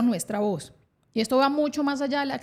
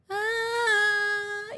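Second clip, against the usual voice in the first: put-on high wail rising in pitch like a crying baby; imitating a French newborn crying in a rising melody; 9 semitones higher than usual. Shown as a demonstration. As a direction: start a baby's cry and slide it upward. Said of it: "vamos a hacer como un bebé francés".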